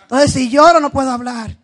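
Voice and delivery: loud, emotional outburst while holding off crying; real welling emotion, bursting out loud; fighting back tears. Real, not acted. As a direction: push the words out forcefully against the urge to cry. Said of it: "ahora tengo eso de llorar".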